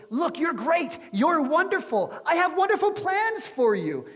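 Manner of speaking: hammed-up positive-thinking pep talk; mock hype-man. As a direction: oversell the flattery like a cheesy motivator. Mocking empty pep talk.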